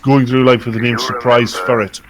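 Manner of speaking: ordinary talk, nothing marked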